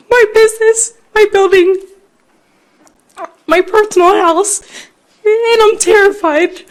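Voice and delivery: real tears, pleading through a choked voice; fighting back tears. Real, not acted. Shop owner begging the committee to spare her store, crying. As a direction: voice breaking high and tearful. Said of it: "I'm trying to not get emotional".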